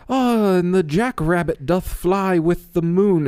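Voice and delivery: high, slow, mock poetic gentleman; flowery old-timey speech. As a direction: recite it like a pompous poet. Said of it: "some guy's like".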